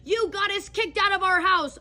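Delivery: high, loud mock scream, slow at the start; imitated screaming blame. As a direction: yell the accusation like the furious roommate. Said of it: "starts screaming".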